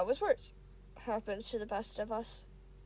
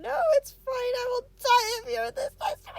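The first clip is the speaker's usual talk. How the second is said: much higher, loud near-shout, voice breaking; panicked cry; 11 semitones higher, 8 dB louder than usual. Acted. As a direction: blurt it out in a desperate wail. Avery blurts panicked confession.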